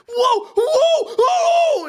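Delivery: high, shouted over-the-top whooping; wildly overreact with big, drawn-out shouts; hammed-up excited freakout; mock freaking out. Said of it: "You're just way freaking out".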